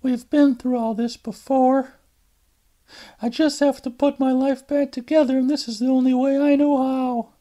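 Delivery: tired daughter excusing herself to her mother; Alice on the phone. Acted.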